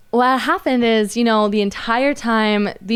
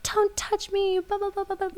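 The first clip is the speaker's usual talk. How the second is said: thin, much higher ranting parody; imitating screaming woman; 12 semitones higher, 7 dB quieter than usual. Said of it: "she was screaming at Raji".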